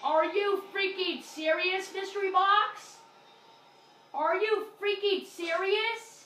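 loud, high outraged shout; furious yell. Real, not acted. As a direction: rage at the mystery box. Bad mystery box roll, rages.

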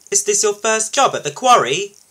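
high, loud called question; calling out. A narrator's reading. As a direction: call it across to a workmate. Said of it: "called Thomas".

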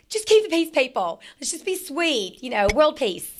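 high, sweet mock pleading; playful peacemaker plea. Middle child pleading for peace, joking.

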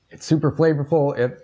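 normal talking voice

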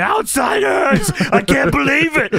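high, drawn-out mock scream; imitated scream. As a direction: mimic a frantic man screaming. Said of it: "and he's screaming".

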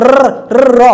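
র rolled quick and light, repeated; demonstrating the r sound. Shown as a demonstration. Teacher drilling the Malay র sound for students. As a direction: tap the র fast. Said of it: "এই রটাকে তারা ছোট্ট করে উচ্চারণ করে".

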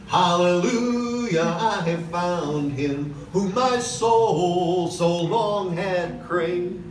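normal talking voice

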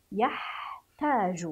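يحتاج in slow pieces, yah, then taaju; syllable-by-syllable reading. Shown as a demonstration. Teacher repeats for pupils, يحتاج slowly in parts. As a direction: draw out يحتاج with a break. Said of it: "من يقرأ هاتين الكلمتين".

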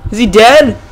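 ordinary talk

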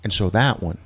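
ordinary talk, nothing marked

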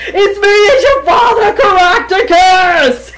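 loud, high, tearful pleading cry. Retold or imitated. Grandpa crying out to his grandson from above. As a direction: beg him desperately, voice breaking. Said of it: "I could see him pleading".

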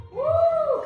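high loud cheering woo; excited whoop. Real, not acted. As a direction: let out a big cheer. Cheering during plank set.

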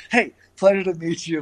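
mock-cheerful hello hiding an injury; breezy cover-up. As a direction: pretend nothing is wrong, light and cheery. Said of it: "I played it off brilliantly".

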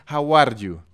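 "How are you" with a tapped Spanish R in "are"; Spanish-R English. Shown as a demonstration. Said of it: "hablar inglés con la R en español".

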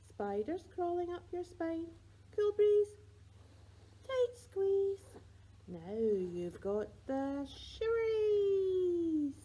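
high, rising sing-song that stretches out slowly at the end; shivery tickle voice building to a drawn-out squeal; playful tickling chant for a baby; tickle rhyme. Pretend.

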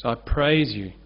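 normal talking voice

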